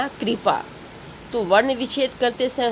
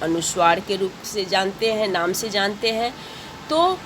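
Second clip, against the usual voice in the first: usual speaking voice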